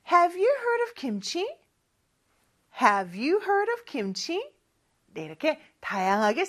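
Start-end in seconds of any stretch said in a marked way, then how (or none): 0.1-4.5 very slow rising question, said twice; slow drill. Shown as a demonstration. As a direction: model it slowly for repeating. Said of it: "한번 연습해 볼게요". (천천히 두 번 따라 하는 연습)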